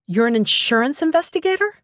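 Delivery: high, rising incredulous question; surprised question. A narrator's reading. Assistant learns her boss's job, incredulous. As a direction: question it in disbelief, climbing to the end.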